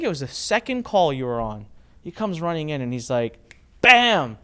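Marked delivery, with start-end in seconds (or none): 3.8-4.4 higher and louder, a burst of triumph; excited exclamation. Retold or imitated. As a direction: punch it out like a win. Jeremy bursting in with news.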